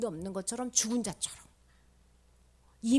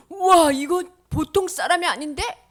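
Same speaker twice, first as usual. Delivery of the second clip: loud, higher wowed exclamation; amazed; 5 semitones higher, 10 dB louder than usual. Acted. Onlookers watching Jesus die, loudly amazed. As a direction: exclaim in hammed-up astonishment. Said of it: "하고 놀랬지만".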